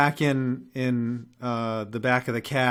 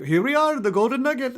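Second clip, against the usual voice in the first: much higher, chirpy mock cab driver voice; mimicking cab driver; 11 semitones higher, 4 dB louder, faster than usual. Retelling how the cabbie announced the wrong stop, high mock voice. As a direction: squeak it out like a cheery driver proudly arriving. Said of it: "he's like".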